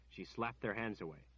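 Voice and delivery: plain reading voice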